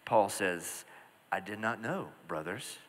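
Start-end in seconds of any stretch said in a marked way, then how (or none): 1.3-2.8 much softer, humbled apology; changed tone. Retold or imitated. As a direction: back down and apologize softly. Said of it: "listen to his tone change".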